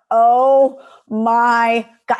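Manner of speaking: slow, loud dismayed gasp of words; oh my God stretched out loud; mock horror. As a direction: drag out the horror. Realizing on-air blunder.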